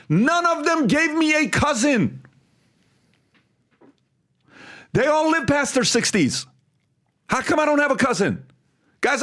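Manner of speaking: high-pitched, exasperated protest; retold argument. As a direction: re-enact arguing your case to your dad. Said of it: "One of the biggest fights I ever had".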